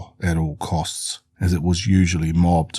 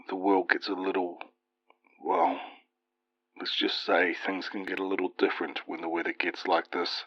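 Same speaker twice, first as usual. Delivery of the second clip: hushed worried father; 9 dB quieter than usual. Acted. Dad hesitating, ominous warning.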